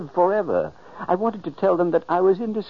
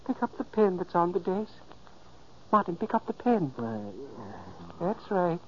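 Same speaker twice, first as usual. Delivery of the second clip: quiet, hypnotic coaxing; soft guiding voice; 8 dB quieter than usual. Acted. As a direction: lead a sleeper softly. Ghost gently guides sleeper.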